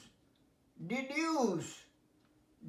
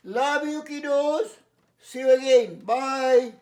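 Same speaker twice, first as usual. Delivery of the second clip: loud farewell; 2 semitones higher, 9 dB louder, much slower than usual. Real, not acted. Teacher signing off to the kiddos, loud and drawn out.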